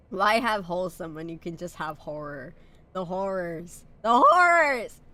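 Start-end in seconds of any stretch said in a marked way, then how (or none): 4.0-5.0 loud, high mock-dramatic wail; theatrical cry. Streamer hamming up a love of horror, loud mock-dramatic cry. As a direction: cry it out in hammed-up dread.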